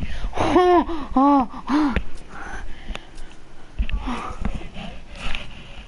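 long wheezy laughter; breathy laugh. Real, not acted. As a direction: dissolve into airy laughter. Long laugh after outburst.

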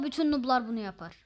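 ordinary delivery

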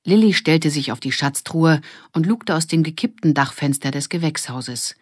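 plain reading voice

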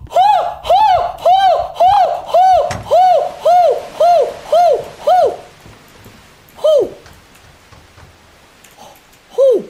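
high, loud repeated hoots; frightened hooting. Real, not acted. Player fleeing in the game, frightened hooting.

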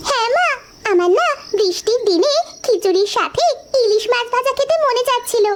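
put-on high childlike pleading voice; a small girl coaxing her big sister for hilsa; little girl. Little girl begs her sister for hilsa fish, high and childlike.

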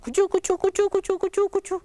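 high-pitched rhythmic chugs; high choo-choo patter; train chugging. Acted. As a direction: imitate a little train rattling past. Imitating the passing train.

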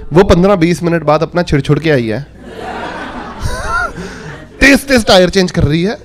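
ordinary talk, nothing marked

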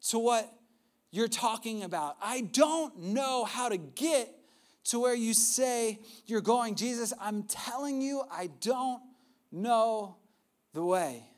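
slow, drawn-out, each word leaned on; pleading confusion. Retold or imitated. Thomas telling Jesus he is lost, slow and pleading. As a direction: plead it, baffled and earnest. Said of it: "but he goes".

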